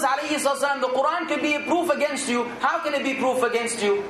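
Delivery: normal talking voice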